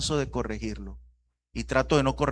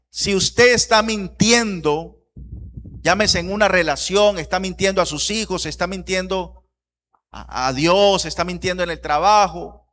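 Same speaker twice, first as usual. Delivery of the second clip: raised, higher preaching voice hammering each item; forceful exhortation; 4 semitones higher, 5 dB louder than usual. Real, not acted. Preacher confronting church members who lie to loved ones, forceful. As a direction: confront the listeners, insistent and loud.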